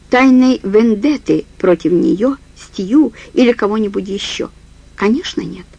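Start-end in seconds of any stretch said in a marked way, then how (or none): none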